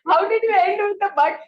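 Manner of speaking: high, loud, fast laughing question. Real, not acted. Teacher laughing at the student's jumbled ending.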